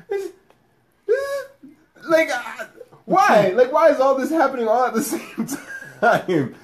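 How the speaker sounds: high, wailing mock despair, broken by laughs; hammed-up distraught whine; mock crying lament. Retelling the breakup to friends, laughing mock wail. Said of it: "And I was like".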